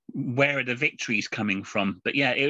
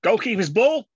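loud, high-pitched call; mock goalkeeper's shout; 9 semitones higher, 6 dB louder than usual. Goalkeeper going for a high ball, loud mock shout. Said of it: "and shouting".

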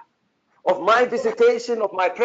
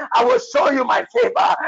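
rising fervor. Real, not acted. Prophet building the promise of favor, rising fervor. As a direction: lift it higher each time. Third take, higher climax.

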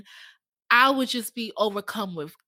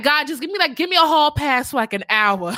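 exasperated grandma; 5 semitones higher, 6 dB louder than usual. Retold or imitated. Voicing grandma's exasperated plea.

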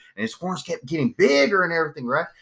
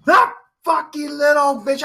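higher, slow, put-on grumble; mock angry dad; 11 semitones higher, 4 dB louder, slower than usual. Dad cursing the girl in the truck, mock angry. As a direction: mutter it like the insulted dad. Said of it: "I'm like".

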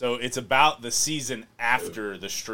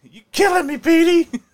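high pleading voice; 9 dB louder than usual. Retold or imitated. Quoting a Remember the Titans scene.

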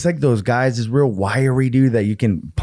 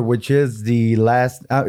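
usual speaking voice

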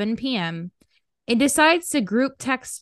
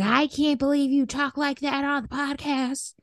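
higher put-on mom voice, mildly disapproving; imitating mom; 5 semitones higher than usual. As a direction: play a mother mildly scolding. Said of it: "She's like".

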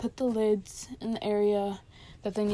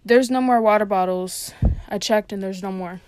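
raised voice, projected to carry; loud enough; 9 dB louder than usual. Retold or imitated. Worker telling shift leader while walking past, loud enough. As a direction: call it out louder, easing slightly by the end. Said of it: "I say it loud enough".

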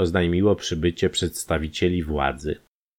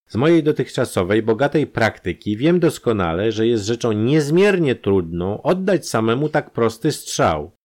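higher and louder expert explanation; lecturing doctor; 7 semitones higher, 4 dB louder than usual. A narrator's reading. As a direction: lay out the proof point by point.

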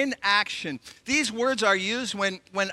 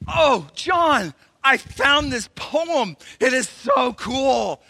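loud, high, thrilled shout; slow, excited shouting of a woman who loves the poem; 5 semitones higher, 6 dB louder, much slower than usual. Retold or imitated. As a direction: blurt it out, delighted with the find. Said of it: "was so excited".

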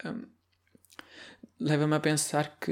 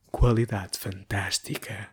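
slow, lower announcer voice; radio voice; 3 semitones lower, much slower than usual. Pretend. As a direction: drawl it like a radio host. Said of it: "Ai, adoro fazer voz de rádio". (voz de locutor de rádio)

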